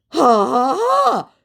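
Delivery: mock laugh. Scammer laughing back at the teasing, high mock laugh. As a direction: toss off a fake cackle. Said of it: "came the response".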